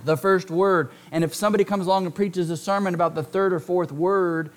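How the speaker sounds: ordinary talk, nothing marked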